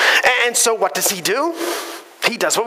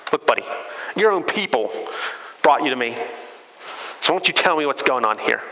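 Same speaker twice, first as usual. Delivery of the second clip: lower, dismissive scoff, mildly imitated; snotty Pilate; 8 semitones lower, 4 dB quieter than usual. Preacher imitating Pilate scoffing at Jesus, lower and snotty. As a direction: brush off Jesus with a sniff. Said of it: "Pilate's kind of snotty".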